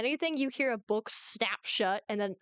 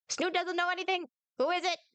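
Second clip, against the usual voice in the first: higher-pitched tiny doorkeeper voice; Snoot, wary little reptile; 6 semitones higher than usual. Acted. Tiny reptile answering through the door, wary and high. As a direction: play a small, suspicious creature, pitched up.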